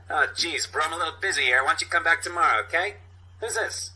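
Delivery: high, fast, nervous hustler; squeaky brush-off from a busy street vendor; Steve Buscemi voice. Retold or imitated. Sasha shooing the cops away, high and fast. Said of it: "Steve Buscemi impersonation".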